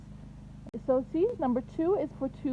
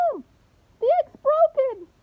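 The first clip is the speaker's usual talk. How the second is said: much higher, louder cry of alarm; excited girl; 11 semitones higher, 8 dB louder than usual. Acted. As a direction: shout it like a little girl who just saw the crack. Girl in story cries out.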